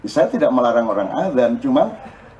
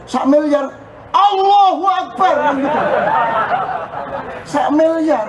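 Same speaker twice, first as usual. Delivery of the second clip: amazed cry; 12 semitones higher than usual. Retold or imitated. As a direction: cry out in wonder at a billion. Reaksi takjub dapat semiliar. (seruan takjub tinggi dan keras)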